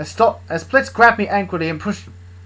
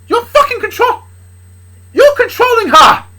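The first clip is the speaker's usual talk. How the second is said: shouted accusation; 12 dB louder than usual. Acted. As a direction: scream the accusation. Dad accuses Charlie, quarrelling.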